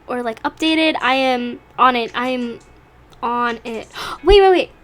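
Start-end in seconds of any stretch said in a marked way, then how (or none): 4.1-4.7 gasp, then excited and much higher; just remembered something, gasp and blurt; sudden gasp. Real, not acted. Suddenly remembering another webtoon.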